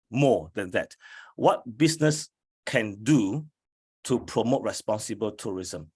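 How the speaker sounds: usual speaking voice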